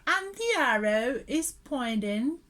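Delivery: ordinary talk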